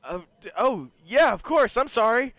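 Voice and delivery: stammer, then hurry to agree loudly; eager, flustered game character; flustered eager agreement. Acted. Startled character agrees to help.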